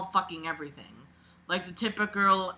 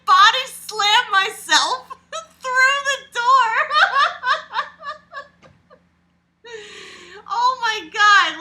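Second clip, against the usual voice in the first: crying with laughter; 9 dB louder than usual. Real, not acted. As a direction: read through helpless laughter. Laughing hard while reading.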